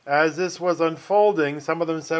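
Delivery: usual speaking voice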